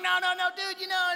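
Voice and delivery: high, loud insistence; insistent no. Retold or imitated. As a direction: wave it off loudly and high. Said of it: "I'm like".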